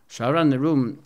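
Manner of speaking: usual speaking voice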